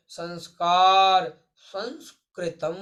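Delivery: संस्कार and संस्कृतम drawn out with a held nasal सं; teacher demonstrating the anusvara slowly; slow nasal demo. Shown as a demonstration. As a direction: stretch the सं of संस्कार slowly through the nose. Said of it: "जैसे बोलते हैं ना".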